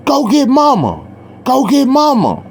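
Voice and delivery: put-on a boy losing the fight yells for his mother; calling for mama. The brother sends his sister for their mom, high urgent call. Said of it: "he tell his sister".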